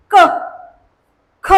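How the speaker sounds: क and ख with a hard, forceful burst of breath; hard consonants. Shown as a demonstration. Teacher demonstrating hard consonants to the class. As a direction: spit out क, then ख, sharply. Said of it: "कठोरपणे उच्चार होतोय".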